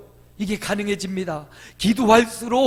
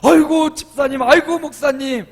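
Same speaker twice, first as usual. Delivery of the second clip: much higher, much louder, exaggerated delight; overjoyed church greeting; 7 semitones higher, 10 dB louder than usual. Shown as a demonstration. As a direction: throw your arms open with the voice. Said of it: "일주일 만에 만나고 보름 만에 만나면".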